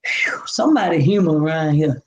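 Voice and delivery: whispered, relieved exhale; sigh of relief. Retold or imitated. Relieved to find a caring principal, whispered. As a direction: breathe it out in relief. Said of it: "I took a sigh of relief".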